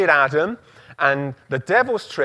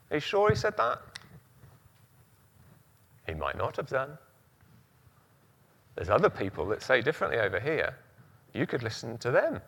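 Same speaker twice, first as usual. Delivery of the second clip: coaxing tempter; 2 semitones lower, 8 dB quieter, faster than usual. Pretend. Voicing the devil's doubt.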